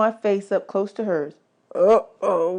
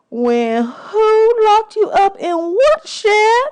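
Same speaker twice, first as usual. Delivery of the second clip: much higher, louder, slow astonished question; shocked disbelief; 7 dB louder, much slower than usual. Acted. Singer hearing he was locked in a shed, loud astonished. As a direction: let the shock ring out.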